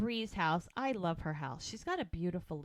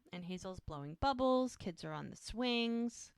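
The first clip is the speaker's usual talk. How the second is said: ordinary delivery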